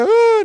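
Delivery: loud high mock sob; exaggerated wail. Mocking a big dramatic cry he did not do, loud wailing sob. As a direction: wail like a crybaby, overdone. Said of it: "não é assim".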